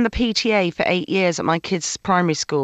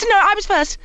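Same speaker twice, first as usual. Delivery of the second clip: higher, firm and indignant. Retold or imitated. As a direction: stake your place in line. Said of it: "I'm wa-, it's".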